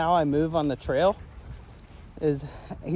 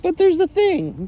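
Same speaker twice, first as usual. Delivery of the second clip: higher, louder, slow dog voice; playful higher voice for the dog's thoughts; voicing the dog; 13 semitones higher, 8 dB louder than usual. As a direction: speak for the curious dog. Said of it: "He's like".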